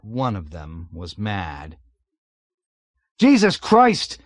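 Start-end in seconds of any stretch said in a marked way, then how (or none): 3.2-4.2 high, loud, drawn-out scream of shock; screaming in amazement. A narrator's reading. Pilot screams at his navigator, horrified. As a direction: scream it in tortured disbelief. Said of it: "he screamed at Arfi in tortured amazement".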